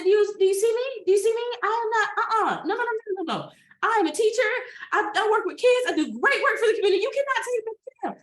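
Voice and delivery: high, loud and rushed begging; frantic pleading. Retold or imitated. Teacher begging the officer, frantic high pleading. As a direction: plead for your freedom in panic.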